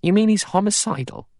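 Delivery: very high, disbelieving question; high-pitched incredulity; incredulous. A narrator's reading. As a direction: question it in sheer disbelief, voice shooting up. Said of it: "Blore said incredulously".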